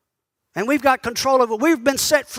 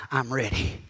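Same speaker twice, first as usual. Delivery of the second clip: much lower and softer, a hushed inviting voice; low sultry murmur; 12 semitones lower, 8 dB quieter than usual.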